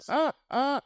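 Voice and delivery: shrieking laugh, pitch jumping up; high burst of laughter. Real, not acted. Laughing at own commentary.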